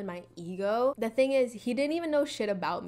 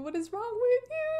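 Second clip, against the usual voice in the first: exasperated; 13 semitones higher than usual. Real, not acted. High exasperated repeat.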